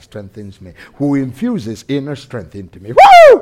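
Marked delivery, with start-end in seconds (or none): 2.9-3.4 sudden whooping cry, much higher and louder; high whoop of praise. Real, not acted. Preacher whooping for joy at the congregation. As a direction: let out a joyful whoop.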